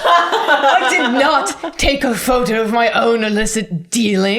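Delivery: indignant denial, laughing. Acted. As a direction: deny it while cracking up. Suspect denies, laughing.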